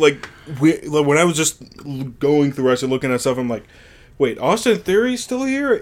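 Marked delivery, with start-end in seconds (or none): 4.2-5.8 high-pitched incredulous question; puzzled double-take, voice lifting high; surprised reaction. Retold or imitated. Host recalling his reaction to the roster, surprised. Mock reaction to forgotten wrestler.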